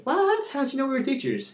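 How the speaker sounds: high-pitched, amazed girl impression; astonished question. Two girls amazed a stranger guessed their job, astonished. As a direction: mimic squealing disbelief, pitch way up. Acted reaction to a correct guess.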